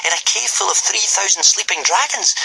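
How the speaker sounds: high, disbelieving question; incredulous thought. A narrator's reading. Hiccup doubting the plan in his head, incredulous. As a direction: ask it in disbelief. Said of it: "thought Hiccup".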